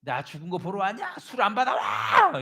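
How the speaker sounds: hammed-up furious master; cursing angry master. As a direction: curse at the servant, ending in a long shout. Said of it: "막 욕을 하면서".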